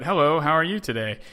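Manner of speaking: lightly voiced kids, each word carefully placed; practiced English. Boys in a pool greeting an American tourist, careful practiced English. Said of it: "like, practiced English".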